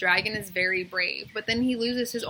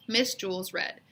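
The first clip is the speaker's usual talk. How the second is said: ordinary delivery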